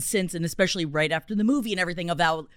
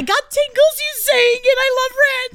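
very high, loud squeal; hammed-up fan squeal; mock emotional gushing; 5 dB louder than usual. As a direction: gush in a squeaky, overcome voice. Mock emotional gushing about the musical.

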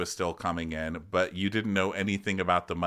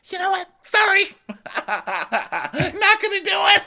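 high, loud, laughing yell, the last words slow; mock defiant shout; 7 dB louder than usual. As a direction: belt it out cheekily between laughs.

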